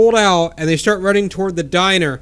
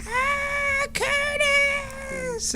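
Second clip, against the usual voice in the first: very high drawn-out mock scream; screaming Curtis; 8 dB quieter, much slower than usual. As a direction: shriek his name in panic, pitched way up. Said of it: "Connie's screaming".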